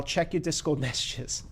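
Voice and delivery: ordinary delivery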